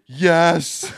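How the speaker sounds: emphatic mock-exasperated shout; retold emphatic yes. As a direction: blurt it out as a big retold yell. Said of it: "My words were simply".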